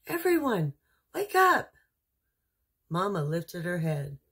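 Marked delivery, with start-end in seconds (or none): 0.1-1.7 high, loud wake-up shout; excited call. Acted. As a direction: rouse the whole family with joy. Dasher calling her sleeping family.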